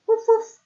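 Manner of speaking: high, loud playful woof woof; dog bark imitation. Shown as a demonstration. Teacher barking like a dog for children. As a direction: bark like a puppy for the kids. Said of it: "what sound a dog makes".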